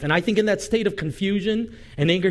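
normal talking voice

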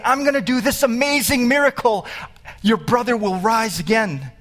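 voicing Jesus's big promise to Martha; excited promise. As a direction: proclaim the miracle with rising excitement.

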